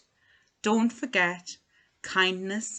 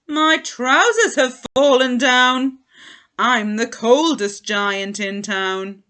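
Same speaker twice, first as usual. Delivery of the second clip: dismayed giant; 3 semitones higher, 7 dB louder than usual. A narrator's reading. Trousers fall, dismay.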